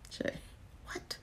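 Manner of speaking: soft unvoiced whisper; whispered aside to viewers. Real, not acted. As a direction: whisper it like a secret. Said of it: "Why you whispered?".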